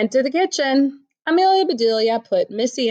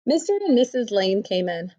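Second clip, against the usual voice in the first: usual speaking voice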